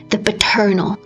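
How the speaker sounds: ordinary delivery, nothing marked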